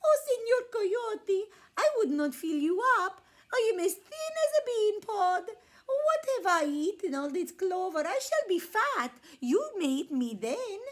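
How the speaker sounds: high, loud pleading lamb; high little lamb begging the coyote. A narrator's reading. Little lamb's voice.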